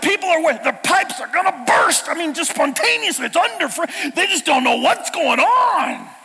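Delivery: loud, high, stumbling mock panic; friend freaking out. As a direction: tumble over the words like a frantic friend. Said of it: "He's freaking out".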